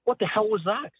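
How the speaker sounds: high, sharp, incredulous challenge; indignant Jim. Retold or imitated. Boss confronting his cameraman, high and indignant. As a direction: demand an answer, outraged. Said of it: "and says".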